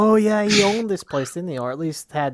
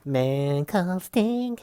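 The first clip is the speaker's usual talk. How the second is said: slow, dramatic and higher; announcer voice; 6 semitones higher, much slower than usual. Pretend. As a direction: intone it like a ring announcer. Echoing announcer intro.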